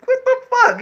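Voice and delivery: high-pitched retold disbelief; shocked outburst.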